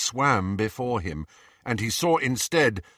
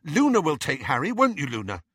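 loud, high "No" easing into brisk insistence; rather fierce; 6 semitones higher, 4 dB louder than usual. A narrator's reading. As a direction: cut in fiercely, pitched up. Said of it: "Ginny said rather fiercely".

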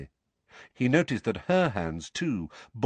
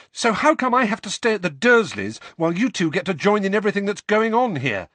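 high, fast, loud tumble of words; angry rush, building; 11 semitones higher, 6 dB louder, faster than usual. A narrator's reading. Harry protesting being left at the Dursleys, angry rush. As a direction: let the words tumble out, getting louder. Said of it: "tumbling over one another in a rush".

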